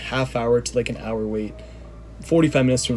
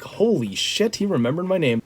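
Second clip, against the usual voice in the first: excited disbelief; 6 semitones higher than usual. Retold or imitated. Jinder greets him by name.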